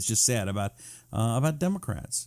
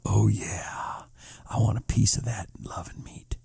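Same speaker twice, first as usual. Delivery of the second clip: breathy mock whisper, no voice; a sly imitated whisper of a secret come-on; 5 semitones lower, 4 dB quieter than usual. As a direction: lean in and whisper it suggestively. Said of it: "leaned in and whispered to me".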